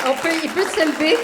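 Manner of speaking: loud, high and jubilant; joyful outburst. Real, not acted. Telling the congregation her father can stand, jubilant. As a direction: proclaim it to the whole church with joy. Joyful healing news to church.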